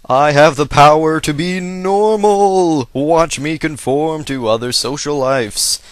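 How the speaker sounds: loud, slow, drawn-out superhero proclamation; hammed-up hero. Gamer imagining a hero with a dull power, mock-heroic shout. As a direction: boom it out like a cartoon hero announcing a power. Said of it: "Imagine if he just got normal".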